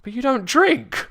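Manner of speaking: high, loud incredulous objection; acted squeaky disbelief; retold disbelief. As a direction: protest in a high, baffled voice. Retold disbelief over gin.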